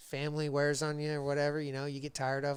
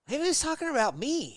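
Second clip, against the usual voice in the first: high-pitched, slightly louder mock epiphany; dawning realization; 13 semitones higher, 6 dB louder than usual. Man realizing the job offer was for him, dawning realization. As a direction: act out a belated light-bulb moment, pitched way up. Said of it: "it dawned on me a couple days later".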